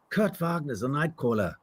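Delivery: lightly voiced German character introduction; name falling away at the end; Nightcrawler. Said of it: "you may know me as this fellow here".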